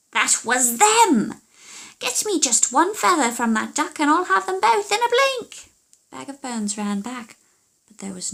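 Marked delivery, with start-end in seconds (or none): 0.1-5.7 shrieking witch fury; furious witch. Acted. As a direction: explode with rage at her daughter. Witch realises, shouts.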